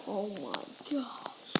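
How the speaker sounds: slow, soft whispered oh my gosh; hushed gasp of disbelief. Real, not acted. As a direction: breathe it out unvoiced. Tense moment hiding.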